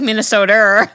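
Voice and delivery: Minnesota, said in a hammed-up Minnesota accent, laughing; mock Minnesota accent. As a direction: play up the Minnesota vowels in Minnesota, teasing. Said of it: "I'll be like Jerry and be like".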